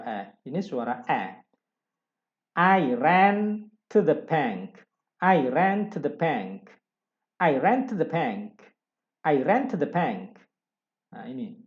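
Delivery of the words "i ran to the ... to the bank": I ran to the bank said slowly, then faster; slow model reading. Shown as a demonstration. Teacher reading a practice sentence, slowly at first. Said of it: "Saya coba baca".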